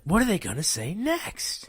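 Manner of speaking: a hint of breathless suspense; mock eager listener. Listeners wondering what comes next, mock eager. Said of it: "They're like".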